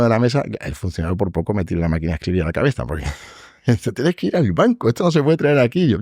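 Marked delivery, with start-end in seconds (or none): 3.9-5.9 high, exasperated telling-off; annoyed scolding. Retold or imitated. Clerk scolding the lawyer for bringing cash, high and exasperated. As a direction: scold him in disbelief.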